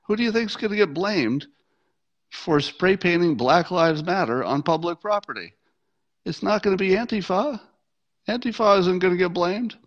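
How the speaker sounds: high-pitched mock indignation at normal volume; retold yelling. Protester scolding graffitiers over blame, mock yelling. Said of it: "So she's yelling at him".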